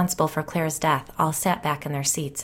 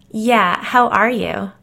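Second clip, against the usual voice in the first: mock concern; over-sweet; fake concern; 5 semitones higher, 5 dB louder, slower than usual. A narrator's reading. Jill asks with fake concern in her voice, mock concern. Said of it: "fake concern in her voice".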